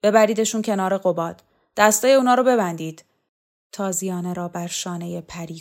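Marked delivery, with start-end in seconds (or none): 0.0-3.0 loud, brisk military order; forceful command, picking up speed. A narrator's reading. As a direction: give orders to the men. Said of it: "راخوف فرمان داد".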